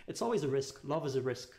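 ordinary talk, nothing marked

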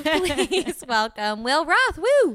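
slow welcome, then a loud high woo; host cheering the guest in; guest intro hype. Real, not acted. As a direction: welcome him like a host revving the crowd.

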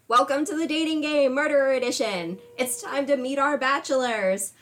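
big game show host welcome; booming pumped announcer. Acted. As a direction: pump up the crowd like a TV host.

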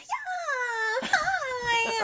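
squeaky high, drawn-out phone greeting; high-pitched phone voice. Shown as a demonstration. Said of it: "it does get a little high-pitched".

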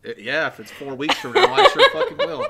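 real laughter spiking up; laughing, building to a shriek. Real, not acted. Laughing reply.

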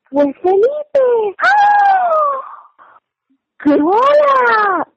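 high, drawn-out wailing outburst; hammed-up shock. Retelling her reaction to the Netflix scene, hammed-up shock. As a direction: squeal and lament in mock disbelief. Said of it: "en shock, y que".

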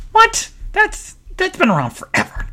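incredulous squeak, very high; shocked disbelief. Retold or imitated. Disbelief at dad's remark.

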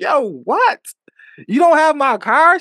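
high, loud, slowed disbelief; can't believe the car is gone; shocked disbelief. Retold or imitated. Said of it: "I was like".

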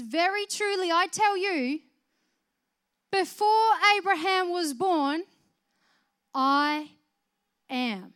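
solemn declaration. Acted. Preacher voicing Jesus to the crowd, loud and solemn. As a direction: declare it with full divine authority.

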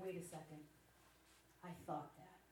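low, quiet, to herself; hushed aha moment in the car; dawning realization. Retold or imitated. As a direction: murmur it as it clicks. Sudden private realization.

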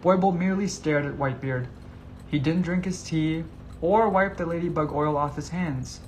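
ordinary delivery

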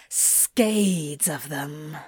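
slow, low hissed words; hissing demon. Acted. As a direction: draw it out in a hiss. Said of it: "Crowley hissed".

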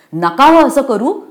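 high, loud begging cry; desperate plea. Acted. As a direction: plead with the men to stop. Girl pleads for the trees.

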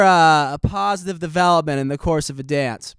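ordinary talk, nothing marked

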